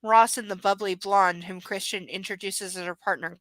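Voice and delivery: ordinary talk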